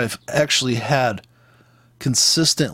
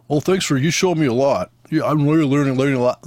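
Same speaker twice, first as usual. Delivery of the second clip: gushing commenter. Retold or imitated. Trader mimics commenters thanking a review guy, mock-gushing. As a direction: put on a fan's voice to ridicule it. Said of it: "people's comments in this thing".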